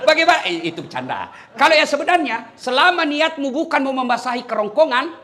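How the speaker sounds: normal talking voice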